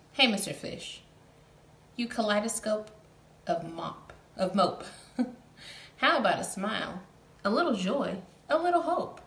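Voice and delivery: loud teasing squid; lightly voiced impolite squid; cheeky squid. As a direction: needle him playfully. Squid teases the fish.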